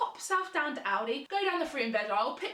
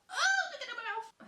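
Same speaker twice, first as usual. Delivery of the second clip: disgusted wail; 11 semitones higher than usual. Real, not acted. Mask on, disgusted.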